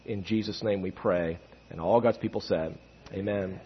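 hushed pastoral prayer. Acted. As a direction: pray quietly and earnestly. Leads the congregation in prayer.

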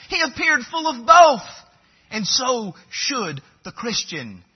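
high pulpit voice with loud peaks; emphatic preaching. Acted. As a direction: proclaim it, punching the key words. Preaches at full force.

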